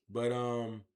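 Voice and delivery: ordinary talk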